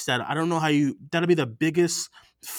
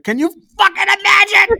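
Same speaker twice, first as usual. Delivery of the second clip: much higher, loud yell; excited shout; 11 dB louder than usual. Real, not acted. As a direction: scream it with joy. Excited about casting news.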